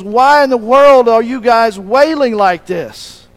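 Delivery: acted exasperated question; incredulous challenge. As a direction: demand it over the noise of wailers. Acts Jesus challenging the wailers.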